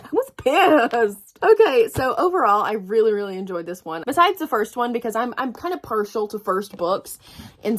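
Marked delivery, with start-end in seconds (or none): 0.0-1.4 slow, loud I was pissed; slow emphatic. Real, not acted. Emphasises her anger.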